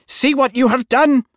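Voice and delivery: very high, screechy witch's cry; shrieking witch accusation; screamed. A narrator's reading. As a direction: scream it in horror, high-pitched. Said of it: "she screamed".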